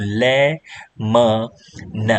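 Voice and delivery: "લે, મ, ન" in separate pieces; sounded out. Shown as a demonstration. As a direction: pause between "લે", "મ" and "ન". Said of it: "શું કહીશું".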